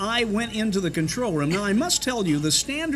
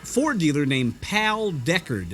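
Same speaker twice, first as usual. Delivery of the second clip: ordinary talk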